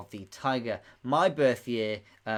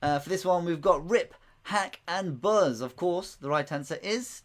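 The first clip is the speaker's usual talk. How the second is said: ordinary talk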